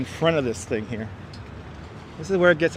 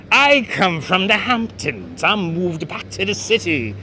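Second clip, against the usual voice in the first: slow, slightly raised posh New York voice; lightly voiced gentrifier; mock gentrifier accent; 3 semitones higher, slower than usual. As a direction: play a snooty Hamptons transplant. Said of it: "my gentrifier Brooklyn accent".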